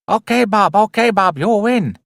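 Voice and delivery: mock Chinese accent, lifted high. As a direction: ham up a Chinese accent in a singsong lilt. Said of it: "the Chinese guy's like".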